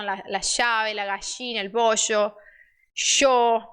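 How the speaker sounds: SH for the LL in "gallina" and "bollo"; "yo" said as sho, "bollo" as bosho; Rioplatense SH. Shown as a demonstration. Teacher showing Buenos Aires speech, SH in "gallina" and "yo". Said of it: "Lá eles falam".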